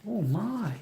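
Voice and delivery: plain reading voice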